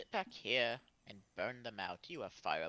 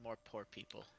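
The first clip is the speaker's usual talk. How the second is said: weary, softer grumble of disdain; eye-rolling disdain; 3 semitones lower, 9 dB quieter than usual. Acted. Player voicing Kenro's reaction to poor people, eye-rolling disdain. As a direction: sigh it out, quietly unimpressed. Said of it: "rolls his eyes and goes".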